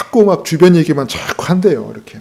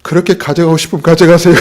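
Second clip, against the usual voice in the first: vendor laughing; 4 semitones higher, 6 dB louder, faster than usual. Retold or imitated. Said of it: "그랬더니".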